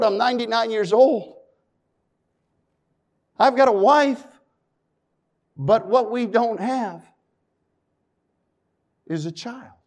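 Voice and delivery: usual speaking voice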